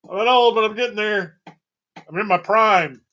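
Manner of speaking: high, loud protesting; loud defensive. Pretend. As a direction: insist on your youth loudly. Defensive about age.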